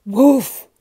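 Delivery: loud, booming woof; big breath, then bark it out loud; explosive loud bark; huge dog woof. A narrator's reading. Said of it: "They went, take a deep breath".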